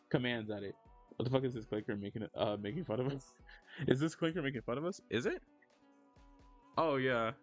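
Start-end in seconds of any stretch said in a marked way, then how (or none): none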